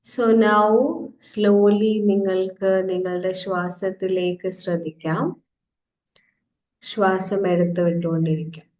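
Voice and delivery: slow, drawn-out meditation cues; guided meditation. Real, not acted. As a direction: stretch each breathing cue, with long pauses. Leads a guided breathing meditation.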